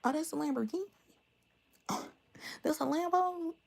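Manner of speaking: high, delighted disbelief with a laugh; excited new lover. Retold or imitated. New lover spotting the old Lambo, high and thrilled. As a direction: squeal it like spotting a dream car. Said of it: "this new lover was like".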